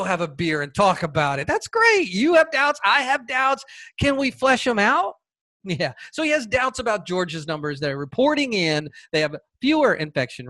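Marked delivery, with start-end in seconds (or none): 1.5-5.1 mock eager. Mock friendly offer.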